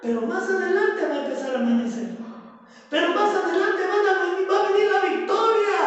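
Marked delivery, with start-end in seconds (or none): 2.9-5.8 loud, high climactic preaching; preaching at full force. Acted. Pastor proclaiming resurrection to the congregation, loud climactic preaching. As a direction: drive it home to the final word.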